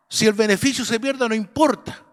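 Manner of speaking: ordinary talk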